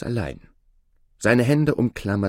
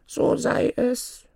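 very high, slow pronouncement; dwarf granting wish; slower than usual. Acted. Dwarf grants Aladin's wish to surface, high slow pronouncement. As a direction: chant it slowly in a high little voice.

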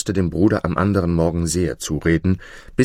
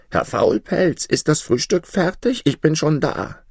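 crying out; 8 semitones higher than usual. A narrator's reading. Boy wakes the sleeping giant, high mock yell. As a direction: call out high as a cheeky boy, mildly projected. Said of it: "schrie aus voller Kraft".

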